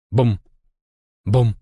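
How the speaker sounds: heartbeat boom. A narrator's reading. Heartbeat sounds.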